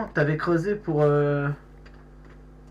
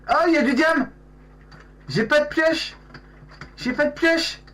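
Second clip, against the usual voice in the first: excited; 14 semitones higher, 3 dB louder than usual. Real, not acted. Finds diamonds without a pickaxe.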